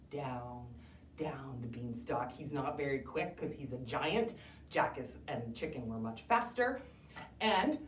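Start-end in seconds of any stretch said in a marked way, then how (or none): none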